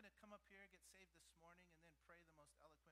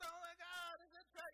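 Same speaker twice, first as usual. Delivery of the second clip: excited parent; 11 semitones higher, 16 dB louder than usual. Retold or imitated. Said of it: "I just".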